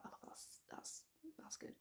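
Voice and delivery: breathy whisper to herself; whispered aside. Real, not acted. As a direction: whisper it under your breath, almost silent. Muttering aside about a show.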